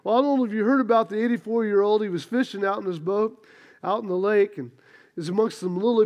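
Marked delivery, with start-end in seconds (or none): none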